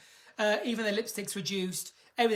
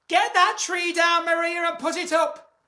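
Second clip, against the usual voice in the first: playful order; 8 semitones higher, 8 dB louder than usual. Real, not acted. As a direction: shout the order at Maria. Ordering Maria to fetch tree.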